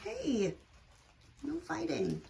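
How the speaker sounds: ordinary delivery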